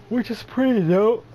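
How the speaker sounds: talking through a yawn; words stretched by a big yawn. Real, not acted. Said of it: "I am so sorry that I'm yawning".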